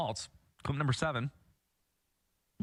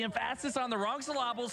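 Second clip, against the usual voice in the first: mock scolding; 7 semitones higher than usual. Mimicking pedantic correction of pronunciation.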